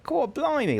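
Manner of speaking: quick, high Cockney squeak of a line; mock Cockney. Streamer showing how the character sounds, mock Cockney. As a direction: toss it off in a jokey Cockney accent. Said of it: "like, a Cockney accent".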